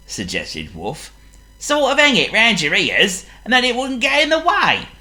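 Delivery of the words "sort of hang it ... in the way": much higher, louder, speeding up; cartoon dog with a bright idea; high quick helpful dog; Wolf's voice. Voices Wolf.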